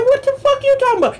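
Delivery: fast, very high-pitched retort; indignant disbelief. Retold or imitated. Driver challenging window washer's demand, high-pitched indignation. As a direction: fire back in high, quick disbelief.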